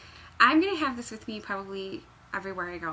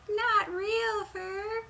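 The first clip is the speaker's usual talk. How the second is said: slow mock voice; 11 semitones higher, much slower than usual. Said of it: "she's like".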